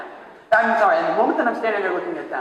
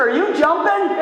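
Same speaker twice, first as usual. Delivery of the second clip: put-on high-pitched boy's question, much higher; kid calling out; 11 semitones higher, slower than usual. Boy in the water asking the man, high kid voice. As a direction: ask it like a cheeky teenage boy.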